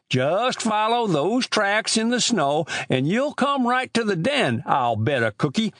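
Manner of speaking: put-on high-pitched rustic old man; old country storekeeper. Old storekeeper grumbling to boys about chicken thieves, high rustic voice.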